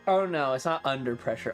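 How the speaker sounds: ordinary delivery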